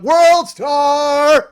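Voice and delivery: high, loud, drawn-out yell; stretched-out mock battle cry; imitated hype shout. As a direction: holler it like a viral-video catchphrase. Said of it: "She got in my car and went".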